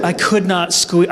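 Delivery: usual speaking voice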